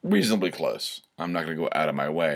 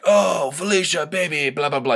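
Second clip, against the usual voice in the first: put-on much higher, strained drunk crooning; mock the drunk husband calling his wife; 9 semitones higher, 4 dB louder than usual. Comedian imitating the drunk husband calling [name], a mock croon. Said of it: "a voice like [name] gargling hot asphalt".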